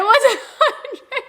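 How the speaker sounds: high-pitched laugh through the words. Real, not acted. Spotting someone taking photos on the beach, laughing. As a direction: break up into a high laugh on the name. Laughs at her own mistake.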